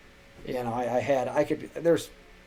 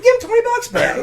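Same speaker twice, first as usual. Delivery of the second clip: squeaky, loud, over-the-top order; exaggerated high command; 9 dB louder than usual. Repeating order, laughing.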